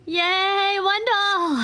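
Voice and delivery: high, drawn-out happy cheer; excited cheer. Real, not acted. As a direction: cheer the name like a delighted fan. Player cheers a character's return.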